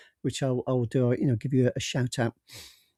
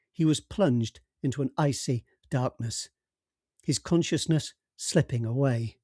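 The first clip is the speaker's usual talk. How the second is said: ordinary delivery, nothing marked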